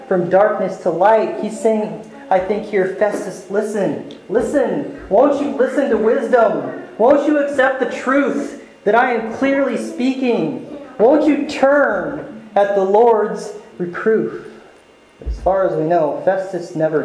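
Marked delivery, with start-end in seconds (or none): 3.0-14.5 higher, pleading call to listen; a touch of Paul pleading; earnest plea. Retold or imitated. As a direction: beg him gently to hear wisdom.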